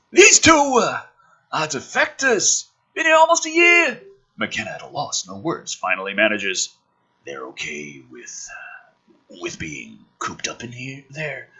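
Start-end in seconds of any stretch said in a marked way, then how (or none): 0.1-4.0 high, loud brash military man; gruff officer voice. Acted. Woodhurst voiced in script. 7.3-11.5 much softer and lower, at a loss; stunned mumble. Acted. McKenna voiced, stunned and quiet.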